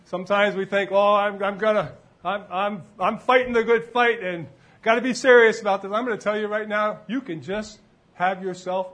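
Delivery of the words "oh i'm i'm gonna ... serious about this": higher, louder imitation of dutiful seriousness; mock earnest. Preacher voicing a believer's thought, mock earnest. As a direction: act out an over-serious believer.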